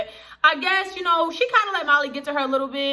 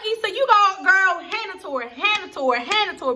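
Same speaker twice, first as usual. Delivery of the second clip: higher, egging-on cheer; cheering her on; 6 semitones higher than usual. Retold or imitated. Viewer cheers on the character mid-argument, cheering her on. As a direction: hype up a friend.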